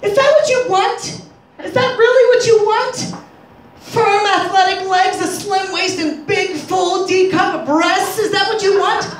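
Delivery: high, pointed wife voice, slow on the body list; wife's voice. Acted. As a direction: play the jealous wife testing him, pitched high. Wife voiced in awkward conversation.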